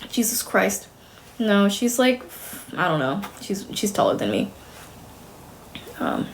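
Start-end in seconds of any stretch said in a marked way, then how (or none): none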